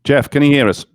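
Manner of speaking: loud call down a bad line. Real, not acted. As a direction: raise your voice to reach him. Calling to remote guest on line.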